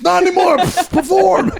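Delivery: high, loud mock producer yell; hammed-up producer shout. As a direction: bark the contestant onstage. Producer punchline bit.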